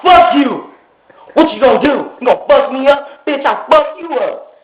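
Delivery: high-pitched, taunting anger; retold defiant challenge. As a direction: act out daring him to fight. Retold quarrel with friend.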